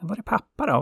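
put-on sun's child asking for its father, high and worried. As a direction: ask like a worried small kid. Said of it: "hennes barn frågade".

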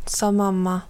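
ordinary delivery

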